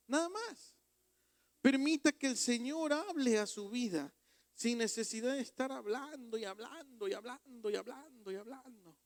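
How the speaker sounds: sermon cry fading down; full-force preaching fading. Acted. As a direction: urge them, then let the repetition die out. Preaching, trailing off.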